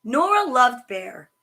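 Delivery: ordinary delivery, nothing marked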